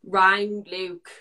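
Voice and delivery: ordinary talk, nothing marked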